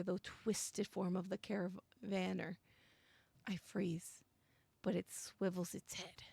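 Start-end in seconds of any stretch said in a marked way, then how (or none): 3.5-6.2 whispered, hushed and still; tense whisper. Acted. Player hiding from the creature, whispering. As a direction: hold your breath and whisper.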